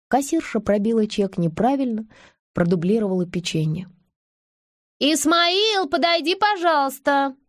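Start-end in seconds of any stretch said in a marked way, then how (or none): 5.0-7.4 much higher, sung out into an intercom; thin sing-song voice. Acted. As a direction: trill it in a thin little voice. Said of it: "пропела она тонким голоском в переговорную трубку".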